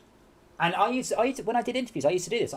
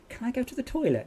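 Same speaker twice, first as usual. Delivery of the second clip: a meek, much higher little voice asking permission; timid new hire; 9 semitones higher than usual. Retold or imitated. Retelling a shy friend asking his boss, timid and high. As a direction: ask like a nervous kid in class. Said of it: "He goes".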